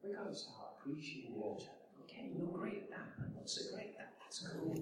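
an intimate, hushed whisper; soft confiding whisper. Real, not acted. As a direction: lean in and whisper it to the room. Intimate appeal to the congregation.

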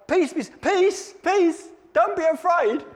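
high, slow, soothing angel voice; calm the frightened like an angel, drawn out; angel's reassurance. Retold or imitated. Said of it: "they have to say".

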